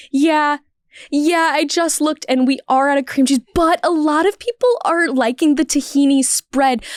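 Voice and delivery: high, dithering, sugary customer-service voice; overly apologetic LA server. Retold or imitated. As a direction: drag it out, sorry about everything. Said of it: "this is how that scenario would go".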